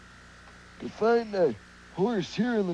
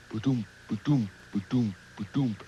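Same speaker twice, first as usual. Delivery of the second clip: put-on soft, low ba-doom heartbeat for kids to copy; gentle mock heartbeat; heartbeat sound; 9 semitones lower, 5 dB quieter than usual. Shown as a demonstration. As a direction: lead the children in a heartbeat chant, lower and softer. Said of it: "Can you say that along with me?".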